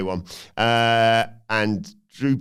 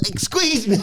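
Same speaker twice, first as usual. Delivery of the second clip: mock announcer; 13 semitones higher, slower than usual. Announcing entrance.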